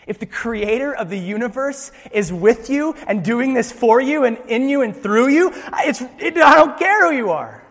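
building to a loud, high-pitched declaration; impassioned, rising insistence; emphatic build. Retold or imitated. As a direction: preach it up to a ringing climax. God's answer building to a climax.

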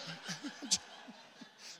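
airy, unvoiced laugh at his own joke; breathless laugh. Real, not acted. Pastor laughs at his own rental joke, breathless. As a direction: laugh it off, caught out. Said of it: "What's wrong with me today?".

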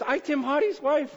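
high put-on voice with broken grammar; teasing imitation of Kristen. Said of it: "she doesn't really talk like that".

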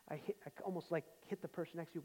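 ordinary talk, nothing marked

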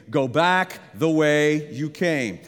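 slow, spaced, leading the congregation; slow call-and-response. Shown as a demonstration. Preacher leading the congregation to repeat, slow. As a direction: draw the words out so they can join. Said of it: "Say that with me".

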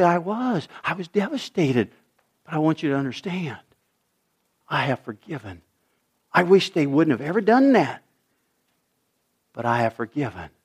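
soft, low and tender, almost hushed; gentle confession. Retold or imitated. Parent softly telling grown child about old hurt. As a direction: ease the child into forgiving, gently.

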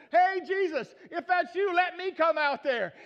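loud, high eager call. Acted. Peter asking Jesus to let him walk out, calling out. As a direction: shout it across the water, last words stretched.

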